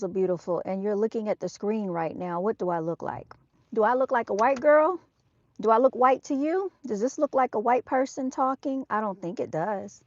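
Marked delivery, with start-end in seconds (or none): 3.7-8.9 indignant. Real, not acted. Indignant at commenters' question.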